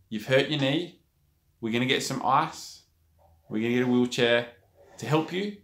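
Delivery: calm, measured reassurance with pauses; steady them, unhurried between phrases. Retold or imitated. Lady settling an injured, panicked player, very calm. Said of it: "in a very calm voice".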